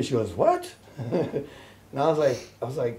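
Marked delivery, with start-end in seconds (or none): 0.3-0.7 very high squeal of disbelief; imitated astonished mother. Mother hears son is coming, imitated astonishment.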